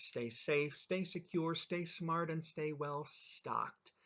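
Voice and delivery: rising sing-song, the last slowed way down; signature sign-off. Real, not acted. Host signing off to viewers, sing-song and drawn out. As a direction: tick off each stay, stretch the final one. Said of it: "my usual quadruple S".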